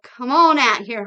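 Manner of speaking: loud, joyful call; calling out excitedly. Retold or imitated. Billy calling Camilla out to LA over the phone, loud and joyful. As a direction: shout it down the phone line.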